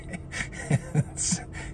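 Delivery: soft, breathy snickering; real giggle under the breath; quiet giggle. Real, not acted. Cracking up at their own gift card joke, quiet snicker.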